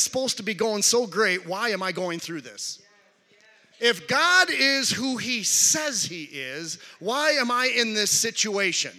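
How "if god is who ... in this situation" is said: louder, slow preaching call; full-force preacher build. Acted. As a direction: lean into it from the pulpit. Preaching climax.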